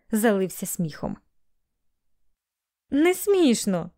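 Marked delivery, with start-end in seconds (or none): 2.9-3.9 higher, drawn-out objection; tearful protest. A narrator's reading. Melanie to Bailey as he laughs at her, protesting. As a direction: protest slowly, a touch upset. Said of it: "запротестувала Мелані, плачучи і сміючись водночас".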